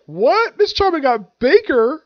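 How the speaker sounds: high, loud cry of disbelief; shocked. Acted. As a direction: blurt it high, stunned by the sight. Acted shocked reaction to transformation.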